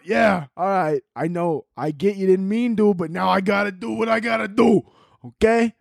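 high-pitched wiseguy reasoning; Italian mobster. Acted. Mobster answering the plea, cartoon menace. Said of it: "big-ass Italian mobsters".

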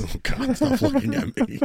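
high, squealing laugh through the words; laughing protest. Real, not acted. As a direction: protest while cracking up. Squirming under a mock seductive stare.

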